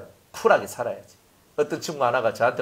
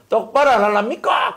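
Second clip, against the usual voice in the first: raised, higher-pitched angry demand; acted angry scolding; 6 semitones higher, 4 dB louder than usual. Pastor acting out losing his temper at congregation, angry shout. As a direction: scold the congregation as if boiling over. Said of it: "제가 열받아가 설레 올라가지고".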